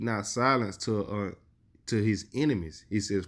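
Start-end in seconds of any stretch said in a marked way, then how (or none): none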